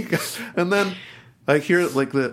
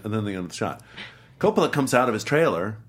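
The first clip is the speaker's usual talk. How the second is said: ordinary talk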